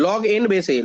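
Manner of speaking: "log n base a" run together as one phrase; pronunciation demo. Shown as a demonstration. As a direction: say "log n base a" in one connected flow, falling at the end. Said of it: "pronounce এইভাবে করি".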